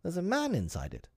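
put-on high-pitched boyish amazement; surprised. A narrator's reading. Boy finding someone in the kennel, surprised.

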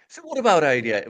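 Brummie accent. Shown as a demonstration. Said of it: "I love the Birmingham accent".

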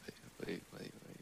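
hushed. Real, not acted. Urgent hushed interruption at table.

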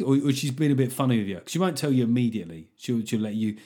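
ordinary delivery

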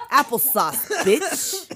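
catchphrase breaking up into laughter; laughing. Pretend. Friend riffing on Boston film lines, laughing. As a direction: say it through giggles.

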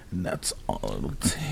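mock sultry, low and soft; slow, seductive purr imitating a commercial; sexy. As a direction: draw it out softly, hammed-up sexy. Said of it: "That's a sexy".

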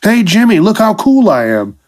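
a bird bragging to his buddy Jimmy; cocky bird. Pretend. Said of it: "They're trying to be assholes".

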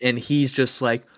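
ordinary delivery

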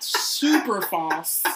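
super false dragged out slowly; mock false voice. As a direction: stretch super false in a fake voice. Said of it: "that sounds".

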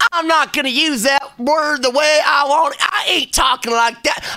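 high, loudmouthed mock-American rant; defiant American. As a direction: play a stubborn American refusing grammar rules. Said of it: "We're in America, we're like".

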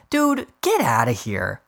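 slow, dismissive brush-off. Real, not acted. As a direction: wave it away. Dismissing reporter.

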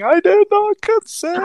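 squeaky, indignant mock victim; hammed-up outraged abductee; high mock victim voice. Quoting abductee again, mocking.

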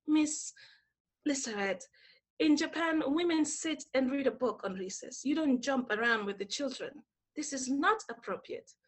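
soft, measured imitation of a disapproving boss; hushed retold lecture on propriety; quiet boss. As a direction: reprimand gently under your voice. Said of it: "she said very quietly".

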